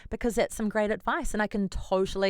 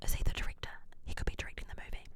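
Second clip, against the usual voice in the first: whispering; 8 dB quieter, much faster than usual. Real, not acted. Gossip hushed aside.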